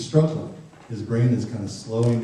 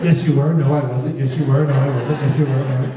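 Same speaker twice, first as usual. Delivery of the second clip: put-on louder, childish back-and-forth squabble; mock bickering; 2 semitones higher, 5 dB louder than usual. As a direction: act out both sides of a petty argument. Acted back-and-forth parent-teen quarrel.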